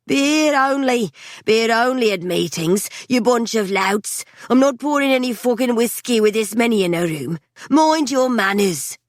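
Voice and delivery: landlady yelling at the rowdy crowd; barmaid shouting. A narrator's reading. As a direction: shout the rules over the racket. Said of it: "stood behind the bar shouting".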